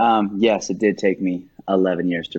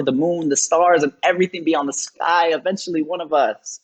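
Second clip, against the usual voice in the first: spoken-word run building steadily higher in pitch; slam poetry; 7 semitones higher than usual. Acted. Poet praising his larger-than-life fiance, building slam delivery. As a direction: drive the lines onward, rising, then break off suspended.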